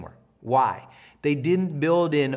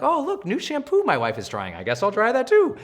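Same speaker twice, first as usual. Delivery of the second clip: idle musing; 5 semitones higher than usual. Retold or imitated. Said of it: "I'd be like".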